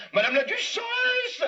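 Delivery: high drawn-out call; slow, high summons. Acted. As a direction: call her from across the castle. Calling the duchess loudly.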